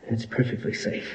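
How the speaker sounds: whisper. Acted. Whispering in the alien tunnel.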